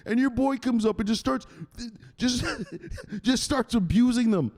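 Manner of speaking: high, agitated mock protest; outraged complainer. Viewer complaining about the enforcer, high outrage. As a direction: protest in a high voice, working yourself up.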